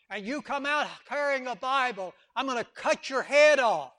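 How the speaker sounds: loud, fervent sermon voice; full-force preaching. Acted. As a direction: preach it to the back row.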